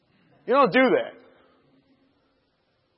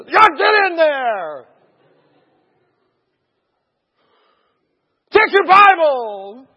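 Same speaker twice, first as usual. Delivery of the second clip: loud, high, drawn-out command; hammed-up commanding yell; shouted order; 8 semitones higher, 10 dB louder, much slower than usual. Officers order him in.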